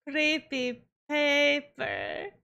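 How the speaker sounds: drawn-out "creepy paper" in vampire voice; slow mock-vampire call; vampire show quote. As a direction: play an out-of-time vampire, stretching each word. Quoting the vampire show's character.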